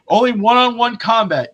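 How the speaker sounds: hammed-up announcement; mock decree. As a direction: proclaim it like a herald. Retelling the decree's rules.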